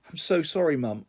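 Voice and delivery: usual speaking voice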